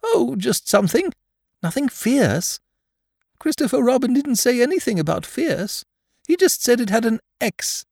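high-pitched storybook bear, brushing off worries; high, simple-hearted bear voice; Pooh, casually reassuring. Acted.

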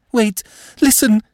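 high, urgent, distressed woman; frantic interjection. A narrator's reading. Madame interrupts the Marquis, frantic. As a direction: break in with high-pitched alarm.

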